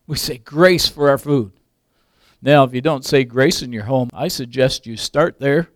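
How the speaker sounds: ordinary talk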